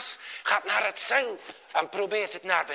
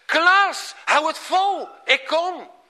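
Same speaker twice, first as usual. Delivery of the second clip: loud, high urgent call; 10 semitones higher, 7 dB louder than usual. A narrator's reading. Skipper calls to Klaas before jumping in, urgent shout. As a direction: shout encouragement across the water.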